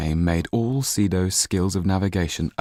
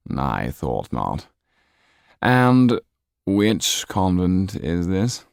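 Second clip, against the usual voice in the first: sly Count; 4 dB louder, much slower than usual. Acted. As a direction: savour each word, amused. Count questions Sido.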